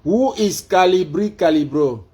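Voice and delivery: high, loud question to a device; calling out. Real, not acted. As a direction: project every word at the assistant. Loud take of the query.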